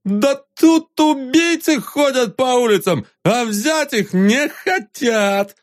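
put-on high slow drunk shouting; drunken threatening rant. Drunk Vanzarov threatens the crowd.

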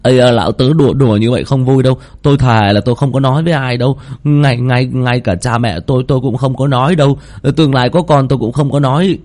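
high, frantic promises; stammering, fearful protest; panicked pleading. Acted. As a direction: swear secrecy in a high nervous voice. Scared friend swears to keep quiet.

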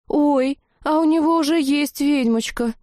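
put-on high, girlish letdown whine; disappointed drawl. A narrator's reading. Blonde finding he has a witch already, disappointed. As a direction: draw it out with disappointment. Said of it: "разочарованно протянула блондинка".

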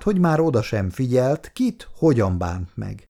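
ordinary delivery

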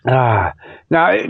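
ordinary talk

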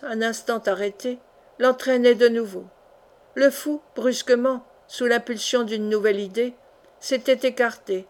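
ordinary delivery

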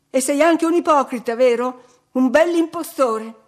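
loud, high, outraged yelling; furious shout. A narrator's reading. Nelly keeps yelling at the scoundrel below, outraged. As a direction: spit the insults out at the top of her voice.

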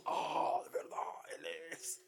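hushed whisper of wonder; the crowd realizing, whispered; awed whisper. Retold or imitated. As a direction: breathe it out in awe.

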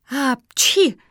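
loud mock sneeze; acted sneeze. A narrator's reading. Pug in the dusty house, sneezing. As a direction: voice a big spelt-out sneeze. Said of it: "снова не выдержала Мафи".

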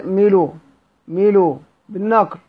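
ميلو with the hamza's vowel moved onto the lam and the hamza dropped; Hamza's transfer reading. Shown as a demonstration. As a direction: say ميلو, no glottal stop. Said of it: "بنقف سيدنا حمزة إزاي؟". (ميلو بنقل حركة الهمزة إلى اللام)

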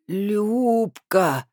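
put-on drunk woman carefully pronouncing every letter; over-careful drunk enunciation; trying to sound sober. A narrator's reading. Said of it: "старательно выговаривая каждую букву".

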